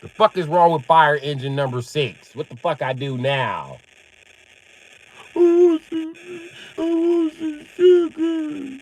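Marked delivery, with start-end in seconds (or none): 5.4-8.8 put-on very high, slow, loud child wail; hammed-up tantrum voice; whining little daughter. Imitating screaming daughter wanting sugar.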